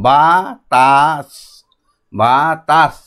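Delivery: "বা তাস" as two long syllables with a gap; sounding out. Shown as a demonstration. Teacher says only the sound aloud, "বা তাস" split by syllable. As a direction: hold বা, then তাস, in "বা তাস". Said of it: "কিন্তু বাইরে বলবো".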